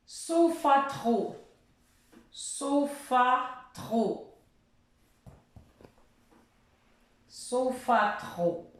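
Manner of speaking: teacher quizzing slowly; slow game word. Shown as a demonstration. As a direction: draw out sofa trop. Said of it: "je vais rajouter une syllabe".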